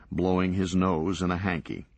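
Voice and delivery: ordinary delivery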